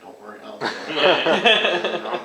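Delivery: slow, loud laughing brag; tossing off a dare with a laugh; cocky retort. Retold or imitated. Farmer retelling his cocky answer to the county, laughing.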